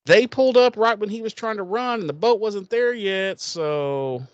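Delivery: high-pitched, loud whining protest; mock whiny pleading. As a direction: whine it like a sulky kid. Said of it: "I'm like".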